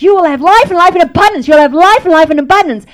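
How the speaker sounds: loud, fervent declaration of life; loud declaring prayer. Retold or imitated. Mother praying over her dying son, loud fervent declaration. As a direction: proclaim it like a mother's command.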